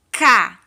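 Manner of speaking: ca punched very loud; syllable beat. Shown as a demonstration. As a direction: hit the syllable ca hard. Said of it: "No segundo quadrinho".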